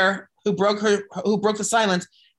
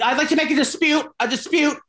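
high, prim mock-polite voice on the phone with the bank; prim, high complaint to the bank; prissy phone voice; 7 semitones higher than usual. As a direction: put on a high, overly proper customer voice. Retold call to the bank.

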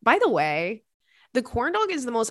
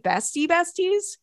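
teasing; 8 semitones higher than usual. Real, not acted. Teasing question about the guy.

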